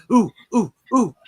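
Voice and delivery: eager oohs racing to help first; excited ooh. Retold or imitated. Racing to pay first, eager excited oohs. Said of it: "sort of a race".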